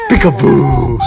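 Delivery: loud playful burst; peekaboo call. Real, not acted. Mother playing peekaboo with baby, loud playful call.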